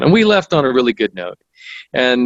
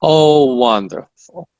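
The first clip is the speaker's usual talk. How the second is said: higher, drawn-out Russian-accented imitation; put-on Russian accent, savouring the words; 5 semitones higher, slower than usual. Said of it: "this Russian accent".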